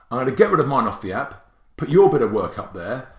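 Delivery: normal talking voice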